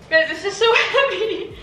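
strained laugh mid-sentence; laughing under strain. Real, not acted. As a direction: laugh while lifting something heavy. Straining under heavy box, laughing.

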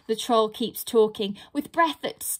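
plain reading voice

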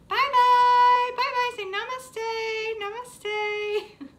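high, drawn-out goodbyes; high singsong farewell; playful farewell. Pretend. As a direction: sing out goodbye to a puppet with the kids. Waving goodbye to the dragon.

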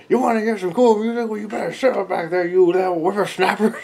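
hammed-up grouchy musician telling off hecklers; musician scolding the back rows, loud and high; cranky scolding. Performer scolds noisy crowd.

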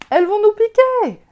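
cri aigu et très fort de peur; panique. Acted. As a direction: crie d'effroi devant l'essaim. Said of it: "en langage de chien". (Pipo terrifié par les abeilles, cri aigu)

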